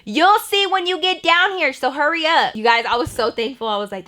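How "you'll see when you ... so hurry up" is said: calling up. Retold or imitated. Grandma calls back up to Alicia.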